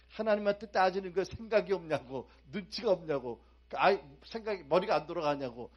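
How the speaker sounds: mock outrage. Preacher scolding Martha for arguing with God, loud mock outrage. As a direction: scold her as if shocked, loud.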